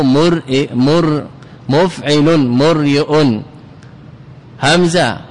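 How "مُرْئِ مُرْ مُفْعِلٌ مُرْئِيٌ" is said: مريء slowly split as mur-i-yun; original form sounded out. Shown as a demonstration. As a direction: break مفعل and مريء into syllables. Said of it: "أصله".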